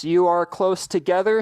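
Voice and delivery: normal talking voice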